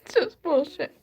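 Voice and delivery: upset and fed up, voice jumping up; real outrage. Real, not acted. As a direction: burst out in bitter disbelief. Upset, denouncing the president's slogan.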